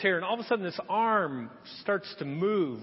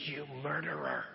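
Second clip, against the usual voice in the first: a much softer, lower accusation with a laugh; mock accusation; 6 semitones lower, 11 dB quieter than usual. Wife accusing her husband over the dead hamster, soft mock accusation. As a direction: mutter the charge half laughing.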